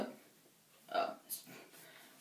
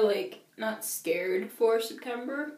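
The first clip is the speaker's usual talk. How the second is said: ordinary talk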